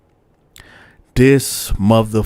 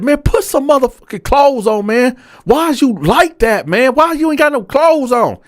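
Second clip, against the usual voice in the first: much higher and louder, yelling through the bars; angry yell; 4 dB louder than usual. Retold or imitated. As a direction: chew him out at the top of your voice. Yelling at naked cellmate.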